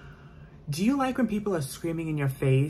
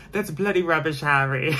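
loud, higher mock-British exclamation; British accent; 3 semitones higher, 5 dB louder than usual. Heard reading Harry Potter in the bedroom, loud British accent. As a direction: belt it out in a hammed-up British accent. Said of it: "you're gonna hear me in my bedroom going".

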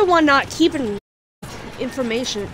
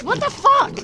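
shocked; 12 semitones higher than usual. Real, not acted. Shock after attack.

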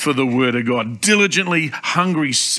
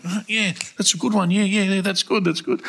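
lightly voiced friend, higher pitched; imitated agreeing friend; 6 semitones higher than usual. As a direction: imitate someone conceding the point in a higher voice. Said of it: "He goes".

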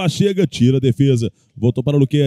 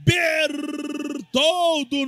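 explosive goal commentary; 5 semitones higher, 6 dB quieter, much slower than usual. Real, not acted. Penalty goal scream.

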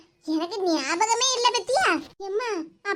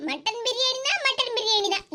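ordinary talk

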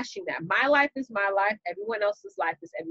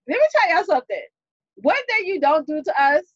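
confrontational; 6 semitones higher, 6 dB louder than usual. Acted. Acted New Yorker telling someone off.

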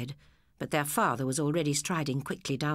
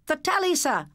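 high, loud eager pupils' answer; class answering; 12 semitones higher, 3 dB louder than usual. A narrator's reading. Pupils answer the teacher together, high and eager. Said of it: "the class answered".

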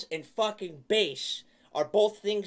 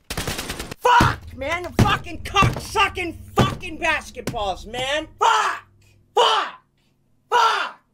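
loud, high, raging shouts of real fury; furious yelling; 7 semitones higher, 9 dB louder, slower than usual. Real, not acted. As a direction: scream it out, completely fed up. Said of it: "grumpy old man who yells at children".

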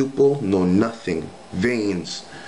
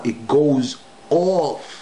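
very slow, higher it goes off; 4 semitones higher, much slower than usual. Shown as a demonstration. The last repeat about the contorted smile, slower and higher. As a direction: drag it out and lift it once more. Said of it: "One more time".

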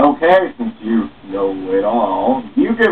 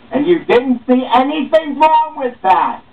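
high, loud disbelief; you can't believe what you heard; shrill-free high outraged disbelief; incredulous; 3 dB louder than usual. Acted. Incredulous at boxer story.

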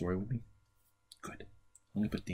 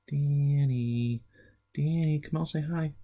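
higher, louder call to coax him out; 8 semitones higher, 8 dB louder, slower than usual. Shown as a demonstration. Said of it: "say his name a little louder".

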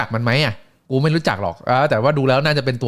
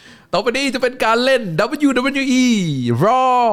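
much higher, booming announcer call; ring announcer; 12 semitones higher, 3 dB louder, much slower than usual. Retold or imitated. Ring announcer introducing Raw to the arena, booming and high. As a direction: belt out the show name like a ring announcer.

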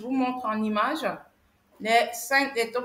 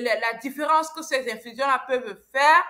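ordinary talk

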